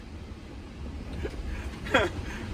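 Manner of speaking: laughing. Real, not acted. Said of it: "Oh my God, I cracked myself up".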